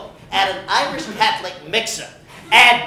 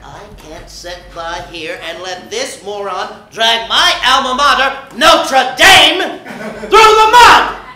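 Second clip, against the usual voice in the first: building rant; 4 dB louder than usual. Acted. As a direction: rise from grumble to roar. Regis ranting, building.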